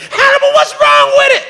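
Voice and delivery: put-on high shrill-pitched girl voice, loud; high squealing girl impression; mock screaming girls. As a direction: squeal it like a panicked party girl. Coked girls screaming at comic.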